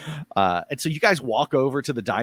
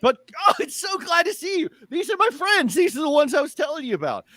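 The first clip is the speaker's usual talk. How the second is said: excited Hutch. Acted. Hutch introduces friends to the woman, gushing. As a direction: gush over old friends.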